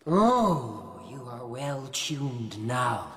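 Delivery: very slow, drawn-out stage delivery; Iago's aside. Acted. Actor plays Iago's aside in rehearsal, slow declamation. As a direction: stretch each word out theatrically.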